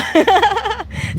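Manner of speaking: high real laugh bursting out; burst of laughter. Real, not acted. Reacting on a live stream to Arnita, real laughter. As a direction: laugh out loud at the comment.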